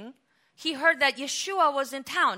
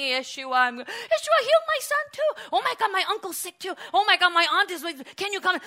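crowd screaming; 8 semitones higher, 6 dB louder than usual. Retold or imitated. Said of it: "they're screaming".

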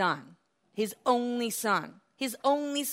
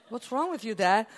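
mock disbelief; 2 semitones higher than usual. [name] mocking the father.

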